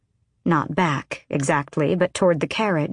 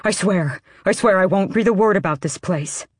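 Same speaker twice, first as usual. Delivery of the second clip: mildly frightened man's promise; a touch of desperate pleading; panicked pleading; 2 semitones higher than usual. A narrator's reading. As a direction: swear it hastily to save yourself. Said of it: "the man cried".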